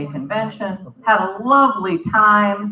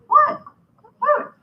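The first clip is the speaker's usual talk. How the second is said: baffled. Retold or imitated. Baffled reply to cab driver.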